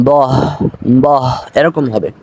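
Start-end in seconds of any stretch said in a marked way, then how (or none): 0.1-0.3 "বহ" ending in a faint breathed h; light h. Shown as a demonstration. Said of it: "হালকা হলে উচ্চারণটা হবে এরকম". 1.0-1.2 "বহ" ending in a faint breathed h; light h. Shown as a demonstration. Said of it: "হালকা হলে উচ্চারণটা হবে এরকম".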